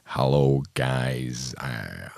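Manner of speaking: low, soft, slow bad American accent. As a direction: sink low into a clumsy American drawl. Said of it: "And he did it again".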